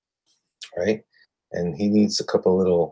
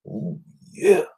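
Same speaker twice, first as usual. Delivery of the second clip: high rising Oh yeah stretched out; wrestler's signature oh yeah; Macho Man catchphrase; 10 semitones higher than usual. Retold or imitated. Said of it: "He talks like the Macho Man".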